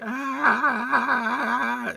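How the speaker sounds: high, loud, wavering mock wail; imitated off-key yell. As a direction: wail a long, out-of-pitch "ah" in imitation. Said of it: "out of pitch yells".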